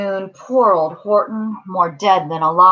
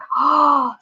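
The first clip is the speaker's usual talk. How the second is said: storyteller's dramatic gasp; startled gasp; 6 semitones higher than usual. A narrator's reading. Storyteller seeing monkeys climb up, startled gasp. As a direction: gasp as if danger appears. Narrator gasps as monkeys appear.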